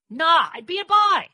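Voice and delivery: high, loud, drawn-out declaration; scornful exclamation. A narrator's reading. Dor proposes a disguise, scornful and loud. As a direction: blurt it out boldly. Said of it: "I said scornfully".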